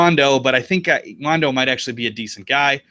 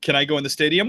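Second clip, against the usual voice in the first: usual speaking voice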